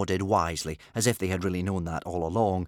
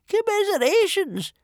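very high, loud character voice; high-pitched, doleful official; gloomy chief judge; 6 dB louder than usual. A narrator's reading. Chief judge consoling the losing chiefs, gloomy high voice. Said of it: "gloomily scratching their names down".

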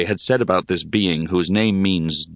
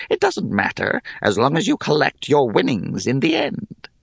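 hag voice; 7 semitones higher than usual. Acted. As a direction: muse like a carefree hag. Hag puzzled about stakes.

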